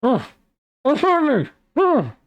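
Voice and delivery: high, panicked cries muffled by a gag; muffled panic through a gag. Acted. Gagged man panics through gag.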